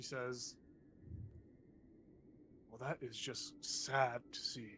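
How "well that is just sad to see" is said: lightly voiced sinister companion, slow and softer; taunting inner voice. Voice in Ashana's head pitying her, slow taunting. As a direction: speak slowly, quietly, with false pity.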